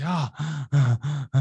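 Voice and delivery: exhausted gasp; breathless groan after the lap; out of breath. Retold or imitated. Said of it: "now I was really out of breath".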